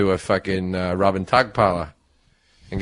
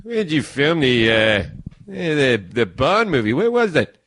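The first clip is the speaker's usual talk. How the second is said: higher, chatty Cliff Clavin voice; Cliff impression; 4 semitones higher than usual. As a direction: play the eager know-it-all barfly. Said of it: "John goes".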